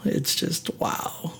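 breathless, whispered wow; a soft unvoiced wow of amazement; awed whisper. Real, not acted. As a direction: hush it out, stunned. Awed by her voice.

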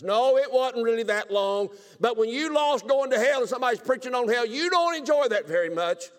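loud, high preaching burst; full-throated revival preacher; fervent preaching. Real, not acted. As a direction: lift it up and preach it hard. Preacher raising voice, emphatic.

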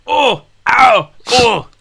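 loud, high ow then oh yeah; pained then relieved cry out; relieved groans. Acted. GM voices Helga's back cracking.

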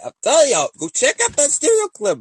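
excited high call-out. Real, not acted. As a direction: hype the viewers up loudly. Calling out to viewers.

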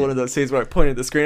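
usual speaking voice

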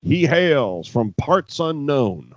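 slow booming ring announcer. Retold or imitated. Mock announcer intro.

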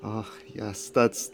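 normal talking voice

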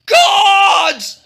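high, drawn-out yell; king's yell. A narrator's reading. King calling out, loud dramatic yell. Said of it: "the king yelled".

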